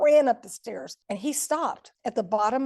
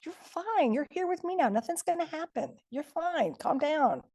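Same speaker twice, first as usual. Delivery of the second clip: dismissive soothing; 7 semitones higher than usual. Retold or imitated. Really diminishing by his actions.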